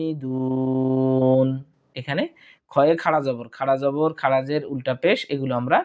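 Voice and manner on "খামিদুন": খামিদুন with the end stretched three alifs long; long final madd. Shown as a demonstration. Teacher models the verse-end stretch, slow drawn-out vowel. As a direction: draw out the last vowel of খামিদুন slowly. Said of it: "আয়াতের শেষে মধ্যে আরজি তিন আলিফ টানবো".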